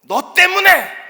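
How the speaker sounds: high, loud accusing yell; shouted blame. Acted. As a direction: scream the blame at him. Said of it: "소리를 질렀어요?". (원망하며 크게 외치는 소리)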